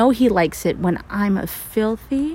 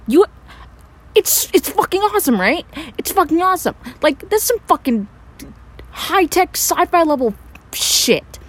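giddy, higher and faster; excited; 7 semitones higher than usual. Acted. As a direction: gush about your brilliant idea. Gushing about invention.

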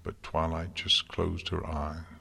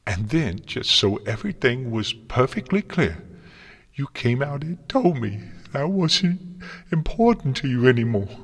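high, loud, wounded accusation; anguished outburst; 7 semitones higher, 6 dB louder than usual. A narrator's reading. Twilight telling Celestia she was made unimportant, anguished. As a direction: let pitch and volume climb in hurt. Sobbing student accuses her mentor.

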